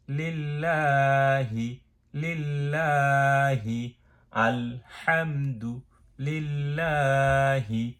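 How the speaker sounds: আলহামদু লিল্লাহি slowly with লিল্লা held one beat; slow drill. Shown as a demonstration. Teacher repeats আলহামদু লিল্লাহি slowly for students. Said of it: "এক আলিফ টানতে হবে".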